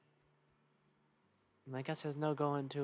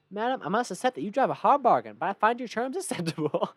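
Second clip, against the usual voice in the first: loud, high, grandiose salesman; hammed-up trader; 5 semitones higher, 8 dB louder than usual. Trader accepts madam's bargain, hammed-up and loud. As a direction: accept with a theatrical flourish.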